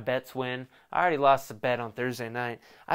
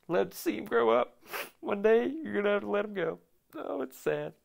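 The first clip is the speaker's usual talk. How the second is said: high, put-on wistful voice; high-pitched mock heartbreak; mock-sentimental parent; 9 semitones higher than usual. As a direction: coo like a parent sad to let their baby go. Mock-sentimental parent seeing child grow up.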